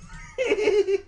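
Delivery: very high, short giggle. Real, not acted. Reader reacting to Daddy's lame joke, high giggle. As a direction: let out a brief high-pitched giggle.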